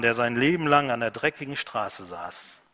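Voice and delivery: ordinary talk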